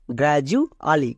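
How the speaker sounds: গ্র্যাজুয়ালি said slowly with a clear j sound, gra-ju-a-li; teacher slowly models গ্র্যাজুয়ালি with a j, not a d; correct gradually. Shown as a demonstration. Teacher showing students the right way, slow clear pronunciation. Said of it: "এর উচ্চারণটা কি দাঁড়াবে".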